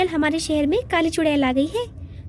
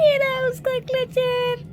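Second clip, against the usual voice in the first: lament; 6 semitones higher than usual. Acted. Mother lamenting stolen daughter.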